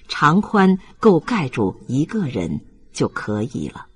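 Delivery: usual speaking voice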